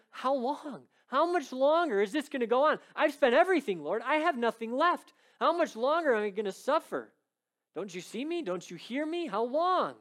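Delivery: voicing a suffering woman who pleads with the Lord; anguished plea. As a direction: beg God, worn out by years of suffering. Bleeding woman crying to God.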